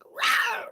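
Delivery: a breathy, voiceless roar like an attacking bear; bear roar imitation.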